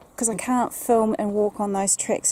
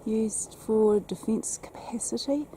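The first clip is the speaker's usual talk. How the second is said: whispering; 7 dB quieter than usual. Real, not acted. Narrating at tower.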